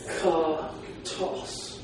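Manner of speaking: Eskatos very slow, syllables spread apart; slow sounding out. Shown as a demonstration. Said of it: "Three syllables".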